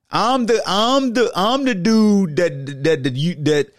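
high, slow mock voice; mock rival voice. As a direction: put on a high mocking voice. Voicing Ball Hawk.

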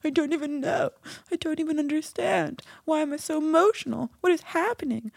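high-pitched tearful mock wail; mock crying. Retelling tearful calls with Tova, mock crying. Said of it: "I was crying and I was like".

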